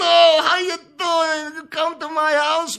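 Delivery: put-on boisterous old-country wrestler inviting you home; imitating Dominic DeNucci. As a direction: belt out a big friendly welcome in a put-on Italian voice. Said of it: "He goes".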